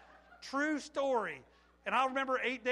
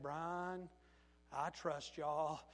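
low, slow, unruffled reassurance; calm; 5 semitones lower, 4 dB quieter, much slower than usual. Retold or imitated. Father reassuring the worker after the shooting, calm. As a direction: say it softly and slowly, completely calm. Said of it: "he just calmly said".

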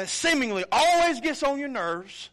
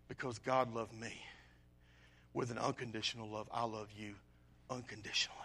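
much softer, dropping to a whisper at the end; hushed tender address; 11 semitones lower, 12 dB quieter, faster than usual. Acted. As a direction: speak it softly, almost whispering, to someone who dislikes you. Preacher voices tender words to enemy.

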